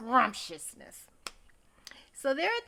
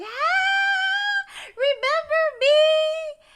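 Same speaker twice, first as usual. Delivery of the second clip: very high, loud, wailing greeting; shrieking planner; 11 dB louder, much slower than usual. Retold or imitated. Event planner greets Carrie, high wailing shriek. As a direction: shriek it out like a frantic long-lost friend. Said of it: "this event planner was like".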